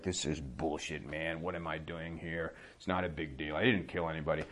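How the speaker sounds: soft resentful mutter; mumbling to himself. Retold or imitated. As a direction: grumble it to yourself, half-swallowed. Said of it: "he's, like, mumbling to himself".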